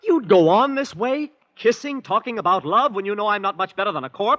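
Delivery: high, loud, indignant protest; outraged cry. Acted. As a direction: throw the accusation at her in disbelief. Outraged lover confronting Kismine.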